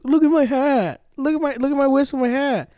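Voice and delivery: hammed-up mimicry behind their back; mocking impression. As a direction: make fun of them in a high voice. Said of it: "it's her doing the impression".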